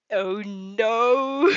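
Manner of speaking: loud amused oh no; real dismay. Real, not acted. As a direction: cry out at the twist. Reader reacts to the plot.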